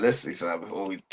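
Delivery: low and fast under the breath, then a whisper; whispered aside. Real, not acted. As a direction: drop to a whisper while restarting the clip. Mutters aside before resuming video.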